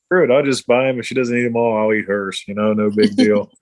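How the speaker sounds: ordinary talk, nothing marked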